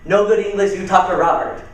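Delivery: broken English in a mock foreign accent. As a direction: brush them off in a foreign accent. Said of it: "as I do the accent".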